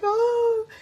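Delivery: high, quavering imitation of a sob; imitating mom crying. Retelling her crying mom answering the phone, a high mock sob. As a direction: mimic her mom breaking into tears. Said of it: "And she's like".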